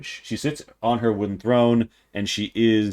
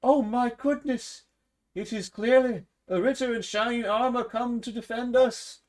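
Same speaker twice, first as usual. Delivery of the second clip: much higher excited woman's voice; peasant woman; 13 semitones higher than usual. Acted. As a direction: gush over the knight in armor. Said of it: "a peasant woman ... says".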